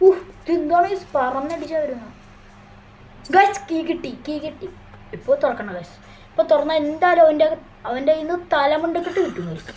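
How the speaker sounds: high, loud thrilled chatter; excited. Real, not acted. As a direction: rush it out buzzing with nerves. Grabbing a key, enemy near. (ആവേശത്തോടെ)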